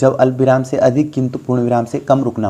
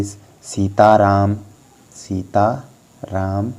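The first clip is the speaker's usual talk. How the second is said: 시타람, drawn out very slowly as one word; slow dictation; much slower than usual. Shown as a demonstration. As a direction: dictate Sitaram slowly with no break. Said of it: "तो दोस्तों आपको ऐसे".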